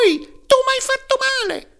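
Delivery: very high, loud little cry of pain; a wooden log's high, aggrieved cry; tiny wailing voice. Acted. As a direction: yelp it like a hurt tiny voice. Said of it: "gridò rammaricandosi la solita vocina".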